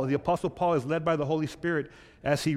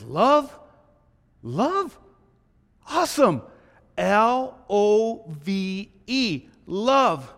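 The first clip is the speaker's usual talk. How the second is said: high, loud, thrilled, letters spelled out slowly; overjoyed at how easy the word is; delighted; 6 semitones higher, 6 dB louder, much slower than usual. Acted.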